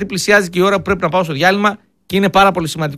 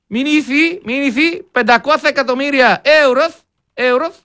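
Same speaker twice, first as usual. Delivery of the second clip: higher-pitched hammed-up Spanish accent, stretched vowels; mock a politician mangling Spanish, higher and sing-song; 5 semitones higher, slower than usual. Radio host mocking Tsipras's Spanish, hammed-up Spanish accent. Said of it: "Ο Τσίπρας ισπανικά κάπως έτσι θα μιλούσε".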